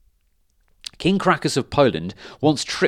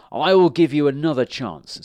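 louder, confident challenge; bold challenge; 4 semitones higher, 6 dB louder than usual. A narrator's reading. Stan boldly challenging the dragon. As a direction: call it out boldly.

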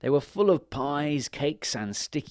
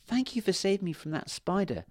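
fairy voice; 9 semitones higher than usual. Acted.

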